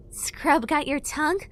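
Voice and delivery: high teasing fairy voice; mock her, playfully. A narrator's reading.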